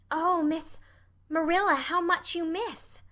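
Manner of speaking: put-on high, heartfelt girlish sigh of pity; dramatic child, pitched well up; dismayed Anne. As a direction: lament it with feeling.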